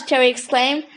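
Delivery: ordinary delivery, nothing marked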